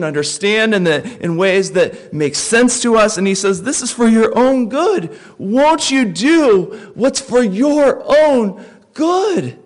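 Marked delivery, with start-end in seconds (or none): 3.6-9.6 slow, high, pleading gently; pleading God. Pretend. God pleading with his people, slow and tender. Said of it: "he pleads with us".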